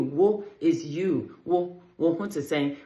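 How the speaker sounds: slow, broken-down "Wo, wo to saying"; slow Twi teaching. Shown as a demonstration. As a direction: spell it out slowly for learners. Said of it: "Wo hon, your hon".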